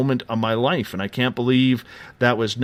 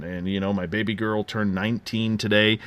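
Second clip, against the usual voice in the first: usual speaking voice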